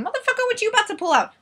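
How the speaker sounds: high, rushed, hammed-up alarm; mock alarm. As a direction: blurt it high and fast in mock panic. Acted alarm as he opens jacket.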